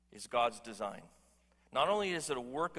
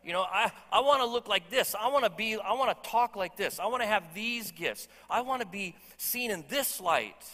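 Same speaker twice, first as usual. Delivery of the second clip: louder, higher, insistent wish list; more eager redo; 6 semitones higher, 6 dB louder than usual. Shown as a demonstration. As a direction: push every I wanna harder, demanding. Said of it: "How about that better?".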